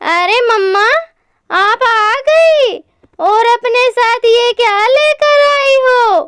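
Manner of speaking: high-pitched chick greeting mummy; curious baby bird. Acted. (जिज्ञासु नन्हे पक्षी की ऊँची आवाज़)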